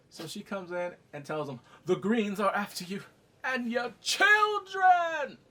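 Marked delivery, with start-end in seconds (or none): 1.8-5.4 mock dramatic warning. Rhaenys warning of the Greens, building to a melodramatic shout. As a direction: build to a high, drawn-out yell.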